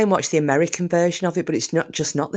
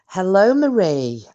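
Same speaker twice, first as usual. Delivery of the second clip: hint of a posh voice, drawn out; posh greeting; 5 semitones higher, 5 dB louder than usual. A hint of posh to a viewer named Marie. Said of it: "I'm going to act really posh now".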